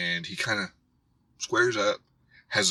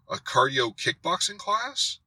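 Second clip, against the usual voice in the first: imitating him, slow and rising into a question; hesitant, high questioning lilt; mock sheepish admission; 7 semitones higher, slower than usual. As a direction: draw it out higher, as if caught out. Acting out the cornered man's admission.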